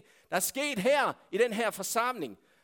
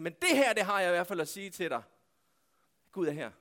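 normal talking voice

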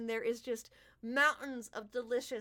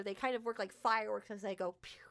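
ordinary talk, nothing marked

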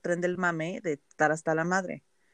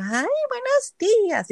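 put-on much higher, slow sing-song Italian-accented greeting; slower than usual. Imitating the cheerful Italian coworker's morning greeting, Italian accent. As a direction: greet her sweetly in an Italian accent, stretched out. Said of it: "y con acento italiano".

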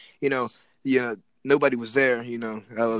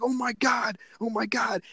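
higher, repeated alarmed cry; mock panic; 8 semitones higher than usual. Retelling the neighbours' reaction, high and alarmed. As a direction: imitate the crowd freaking out.

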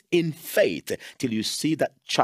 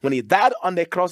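ordinary talk, nothing marked

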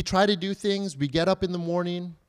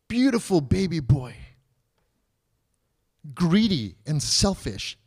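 ordinary talk, nothing marked

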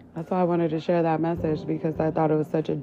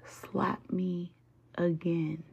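menacing whisper, much softer; whispered dare; 9 dB quieter, much slower than usual. Acted. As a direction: hiss the dare under your breath. Daring an enemy, whispered.

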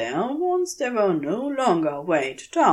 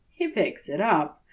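plain reading voice

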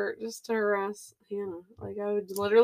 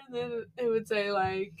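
ordinary delivery

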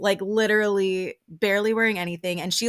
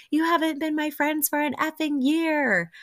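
mock yell; 9 semitones higher than usual. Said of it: "the flashback of her yelling".

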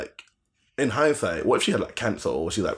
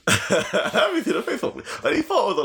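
laughing. Real, not acted. Laughing through the story.

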